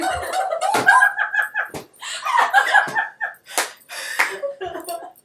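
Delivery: shrieking laughter. Real, not acted. Huge laugh after mishap.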